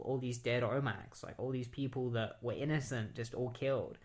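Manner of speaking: ordinary delivery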